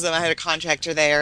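ordinary talk

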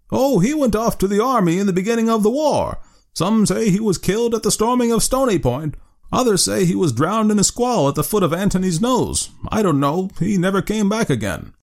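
put-on old man telling Rip his friend is dead, thin and piping. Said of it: "replied in a thin, piping voice".

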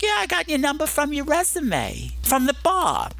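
high, tiny put-on phone voice; teeny tiny voice. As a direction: imitate a caller's high little voice. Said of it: "calling in this little teeny tiny voice".

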